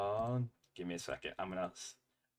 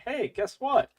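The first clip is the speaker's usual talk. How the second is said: chirpy app notification voice; 11 semitones higher, 4 dB louder than usual. Retold or imitated. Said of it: "had it pop up on my screen saying".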